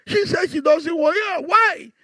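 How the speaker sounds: high-pitched incredulous questioning, acted out. Pastor asking the husband about the refused gift, incredulous. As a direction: demand an explanation in disbelief. Retold shocked reaction to rejected gift.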